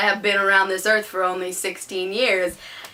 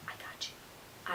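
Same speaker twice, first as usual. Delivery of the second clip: breathy, secretive whisper; 24 dB quieter, slower than usual. Acted. Teen vlogger promising proof to critics, whispered.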